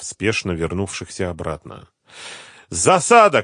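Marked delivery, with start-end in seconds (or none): 2.7-3.4 high, loud cry of warning; shouted alarm. A narrator's reading. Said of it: "крикнул один из них". (тревожный крик)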